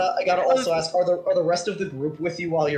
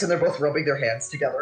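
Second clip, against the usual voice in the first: ordinary talk, nothing marked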